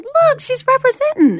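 very high, loud imitated exclamation; excited pointing-out. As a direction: exclaim it as if showing everyone. Imitates him pointing out the belt.

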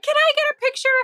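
very high, squealed request; excited fan. Retold or imitated. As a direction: imitate a thrilled fan asking eagerly. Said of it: "Jillian was so excited".

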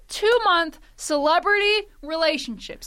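high, loud indignant rant; mock outrage. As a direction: protest like a wronged fan.

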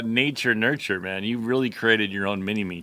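normal talking voice

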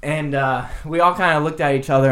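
ordinary talk, nothing marked

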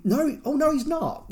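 high, startled no; sudden realisation. Retold or imitated. Podcaster correcting his own muddle, startled and high. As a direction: catch yourself mid-mistake, pitch jumping up.

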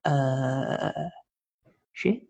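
low, quiet, drawn-out uh; stunned reaction. Retold or imitated. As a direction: let a muted curse slip out in retold shock. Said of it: "And I was like".

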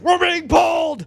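slow, high-pitched mock wail; hammed-up shout of helpless alarm; mock panicked cry. Co-host teasing about panicked adults, hammed-up cry. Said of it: "adults grabbing you like".

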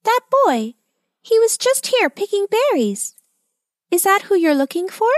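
very high, squeaky bird character; tiny squeaky voice. A narrator's reading. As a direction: pipe up like a little bird. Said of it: "she heard the voice again".

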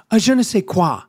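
"je ne sais quoi" in a mock French accent; "je ne sais quoi" with a hammed-up French accent; French accent, second try. Shown as a demonstration. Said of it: "I'll do it again".

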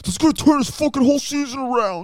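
high squeaky mock voice; mocking impression. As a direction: mimic a friend in a squeaky voice. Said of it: "Chris was like".

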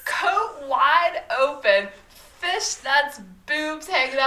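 high, slow, delighted listing; excited. Real, not acted. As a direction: savor each scandalous detail. Excitedly listing outfit details.

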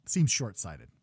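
ordinary delivery

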